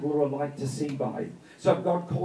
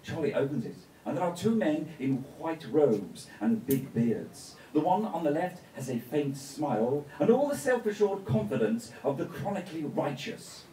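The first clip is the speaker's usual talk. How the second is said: ordinary delivery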